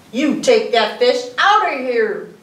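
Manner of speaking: loud, slow scolding; angry yell. Acted. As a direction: tell them off. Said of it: "they yelled".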